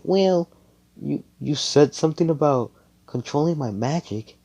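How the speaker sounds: high-pitched, hesitant voice; tentative girl character. Acted. Noelle brings up controlling her magic, tentative.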